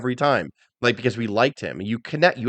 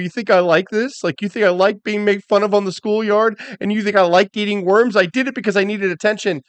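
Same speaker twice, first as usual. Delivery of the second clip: imitated aggrieved promo, pitched up; Mick Foley; 7 semitones higher than usual. Foley in sit-down interview, imitated aggrieved protest. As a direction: retell a wounded wrestler's challenge, voice raised in pitch.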